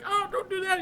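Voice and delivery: very high comic whine; mock pained protest. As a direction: imitate a movie character pleading in a high voice. Quoting pained film character.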